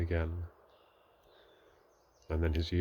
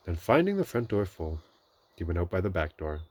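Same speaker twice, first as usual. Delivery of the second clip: plain reading voice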